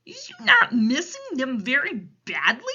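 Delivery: troubled storybook giant; Big Friendly Giant. A narrator's reading. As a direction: ask with big pitying emphasis. Giant asks orphan with concern.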